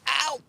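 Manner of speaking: a high yelp of pain; sharp high ow. Real, not acted. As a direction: yelp as the teeth catch you. Dog nipped his finger.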